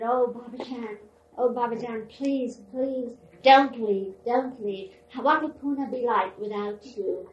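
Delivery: a loud, choked plea recalled from the dream; crying plea. Retold or imitated. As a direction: relive begging her not to leave. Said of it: "And I was crying".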